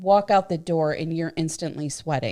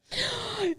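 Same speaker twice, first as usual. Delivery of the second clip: shocked high gasp; sharp high gasp; 7 dB quieter than usual. Real, not acted. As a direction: gasp in surprise. Mock shock at drink count.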